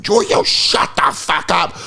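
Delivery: whispered high mock tough-guy yell. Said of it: "Where you're like".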